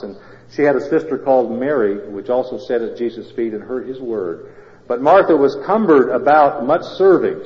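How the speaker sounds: loud, declaiming Bible reading; scripture reading. Acted. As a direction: proclaim the verses to the whole church. Reads scripture to congregation.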